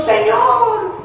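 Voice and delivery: high, loud mock shout of Lord; imitating a loud prayer shout; shouted call. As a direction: call out to wake a sleeping master. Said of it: "Tenemos que andar gritando".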